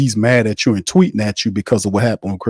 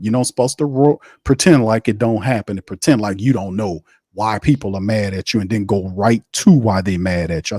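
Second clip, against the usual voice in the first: ordinary talk, nothing marked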